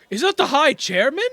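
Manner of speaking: high, loud hopeful question; surprised onlooker. Acted. Citizen spotting the chairman's carriage, surprised. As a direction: gasp it out in disbelief. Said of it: "it's like".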